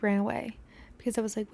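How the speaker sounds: normal talking voice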